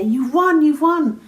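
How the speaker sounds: excited announcement. Acted. Imagined card announces a win.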